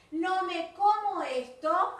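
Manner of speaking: a firm don't-fall-for-this warning; emphatic warning. Shown as a demonstration. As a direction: warn the class, slow and firm. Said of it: "atención".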